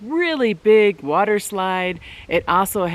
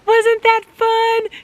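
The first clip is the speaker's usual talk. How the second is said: high, slow, bright "Wasn't that fun?"; excited cheer; 5 dB louder, much slower than usual. Retold or imitated. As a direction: cheer a scared kid on after the ride. Mother cheering scared daughter.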